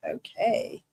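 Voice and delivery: normal talking voice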